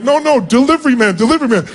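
retold high-pitched, flustered insistence; flustered protest. Waving off an overjoyed woman's thanks.

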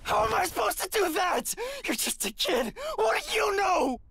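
high, bitter shouting; bitter outburst. Acted. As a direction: lash out in grief. Grieving man lashes out.

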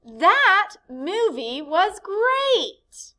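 slow, high, loud enthusiasm; excited. Shown as a demonstration. Teacher reading an exclamation example, excited. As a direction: read it like you loved the movie. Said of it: "Here's our exclamation point".

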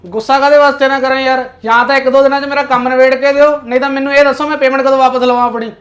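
angry. Acted. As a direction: shout it, you've been cheated. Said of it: "ਗੁੱਸਾ ਨਾ ਕਰੋ".

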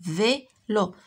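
"Vélo" split into vé and lo with a gap; syllable by syllable. Shown as a demonstration. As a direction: say "Vélo" in two separate beats. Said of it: "عندنا دو سيلاب".

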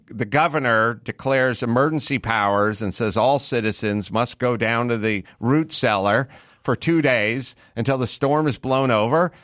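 ordinary talk, nothing marked

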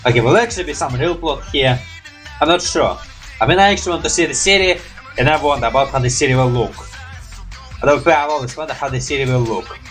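usual speaking voice

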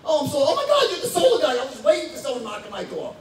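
overjoyed homeowner fawning over the salesman; a pre-sold client greeting the solar guy in a hammed-up gush; gushing eager customer. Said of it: "they're like".

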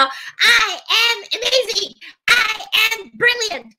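high, drawn-out chant; 掛け声で唱和. Shown as a demonstration. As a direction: lead the crowd, very high and slow. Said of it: "せーの". (高く伸ばして力いっぱい唱える)

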